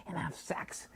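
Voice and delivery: breathy whisper, no voice; whispered tease about sex. Retold or imitated. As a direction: whisper the last words like a secret. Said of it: "she kept telling me this".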